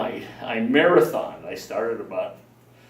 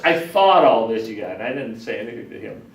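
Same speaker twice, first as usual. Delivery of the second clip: ordinary delivery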